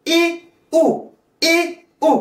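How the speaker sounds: "I, U" twice, each vowel long and high; vowel demonstration. Shown as a demonstration. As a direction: sustain "I" and "U" separately, high and even. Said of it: "les deux voyelles faibles".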